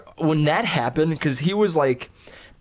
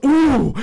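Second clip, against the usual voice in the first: high, loud impressed ooh; a sharp rising ooh as the hit lands; mock ooh; 13 semitones higher, 7 dB louder than usual. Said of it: "him going".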